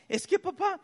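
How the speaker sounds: put-on high-pitched, choked-up child; imitated tearful daughter. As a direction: mimic a girl too upset to talk. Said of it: "cuando te gana la emoción no puedes ni hablar".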